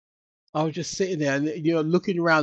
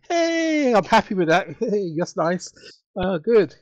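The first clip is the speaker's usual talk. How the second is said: high, drawn-out cheer easing into satisfaction; higher-pitched, pleased little celebration; pleased reaction; 6 semitones higher, 4 dB louder, slower than usual. Retold or imitated. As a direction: act out being happily chuffed. Acting out reaction to first call.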